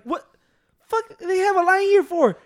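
sudden high, loud disbelief; high, loud "what, a line here?"; shocked outburst. Retold or imitated. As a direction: blurt it out in disbelief. Angry outburst at store line.

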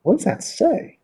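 puzzled. Retold or imitated. Puzzled by a bus sign.

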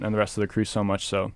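normal talking voice

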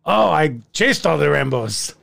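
mock Irish sliding into Slavic; bad Irish accent that sounds Russian or Polish; botched Irish brogue. Leprechaun grumbling about the parade, Irish brogue gone Slavic. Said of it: "I'm actually from Slovakia".

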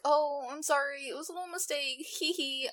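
sing-song mocking excuse, hee hee; mock apology. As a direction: make fun of a flimsy sorry. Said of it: "the way he's making it seem".

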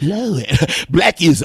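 ordinary delivery

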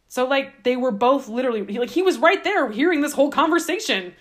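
ordinary talk

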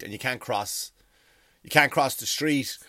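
usual speaking voice

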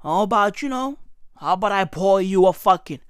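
ordinary talk, nothing marked

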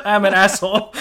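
laughing through the words at your own blunder; sheepish, laughing self-scold; breaking into real laughter mid-line. Real, not acted.

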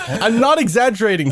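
ordinary talk, nothing marked

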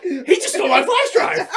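put-on high, squeaky voice of the old lady pointing a finger; mock old lady accusing. Old lady accusing him to the library staff, high and shrill. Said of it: "And she was like".

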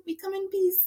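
high, playful alien greeting voice. Pretend. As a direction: greet earthlings as a friendly alien. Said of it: "that's how we greet the aliens".

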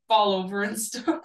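usual speaking voice

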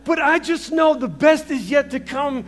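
loud, high rising sermon climax; fervent pulpit crescendo; full-force preaching. Real, not acted. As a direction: build to a shouting finish.

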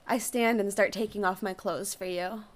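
ordinary delivery

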